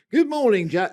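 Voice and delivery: put-on loud, high, botched Australian accent; bad Australian accent. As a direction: overdo a bad Aussie greeting, then break off. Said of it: "that's a really bad Australian accent".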